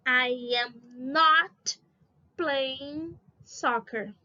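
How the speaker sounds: very slow, stretched-out English words; slow modelling. Shown as a demonstration. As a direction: lay out each word separately for the class. Teacher builds negative sentence slowly. (bem devagar, palavra por palavra)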